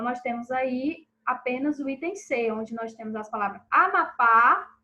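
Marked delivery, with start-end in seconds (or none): 3.7-4.8 Amapá with a loud, held final PÁ; teacher showing the stressed PÁ in Amapá. Shown as a demonstration. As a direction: hit the last syllable of Amapá. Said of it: "o pá é a sílaba tônica".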